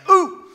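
loud, high-pitched whoop; mock shocked ooh. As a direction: let out a sharp, playful ooh. Reacts to startling scripture claim.

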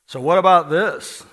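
loud, slow, high needling question; mock challenging questioner. A questioner challenging the preacher, loud and slow.

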